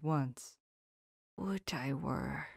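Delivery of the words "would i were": soft, slow rueful sigh; sad murmur. Acted. Professor softly answering his angry friend, rueful. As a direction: answer with quiet regret. Van Helsing's sad reply.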